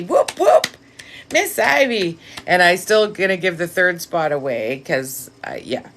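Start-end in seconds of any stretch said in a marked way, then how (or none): 0.0-2.2 high, bouncy whoop whoop; welcoming a viewer with a cheer; excited whoop. Real, not acted.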